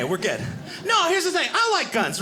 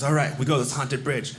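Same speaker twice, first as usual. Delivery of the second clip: low tour guide; 8 semitones lower than usual. Retold or imitated. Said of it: "He goes".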